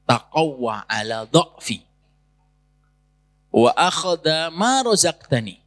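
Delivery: slow, chanted Arabic supplication; prayer recitation. Acted. Preacher recites the wronged fisherman's prayer, slow chanted supplication. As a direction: intone the prayer slowly and earnestly.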